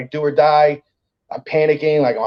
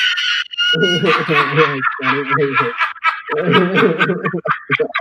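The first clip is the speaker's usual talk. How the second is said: long high cackling laugh; helpless run of real laughter; real hearty laugh; 5 semitones higher than usual. Real, not acted. Comic cracks up at his own arrest story, long laugh.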